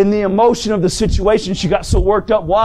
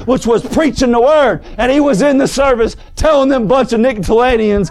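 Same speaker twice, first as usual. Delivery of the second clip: raised, driving pulpit voice; full-force preaching; 4 semitones higher than usual. Real, not acted. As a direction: preach it hard to the congregation.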